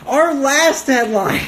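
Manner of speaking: high, drawn-out mock announcer; grand announcement. Host announces the final news item, hammed-up high announcement.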